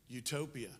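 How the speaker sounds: usual speaking voice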